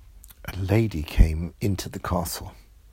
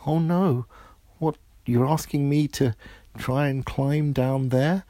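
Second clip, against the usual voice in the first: frightened princess; 8 semitones higher, 4 dB louder than usual. Acted. Princess asked to climb down.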